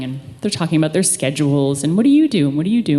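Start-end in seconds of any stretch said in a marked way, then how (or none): 2.0-2.5 chatty mom voice. Retold or imitated. Moms quizzing each other in car.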